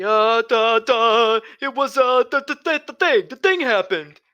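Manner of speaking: flustered excuse-maker. Pretend. Making up an excuse for the lost disc, mock fluster. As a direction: stumble over the words in a high put-on voice.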